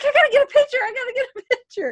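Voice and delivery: high, loud, giddy, laughing at the end; excited. Retold or imitated. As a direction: gush like a starstruck fan. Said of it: "I was so excited".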